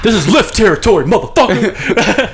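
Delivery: hammed-up turf-war driver; tough Lyft driver. Mock Lyft driver threat.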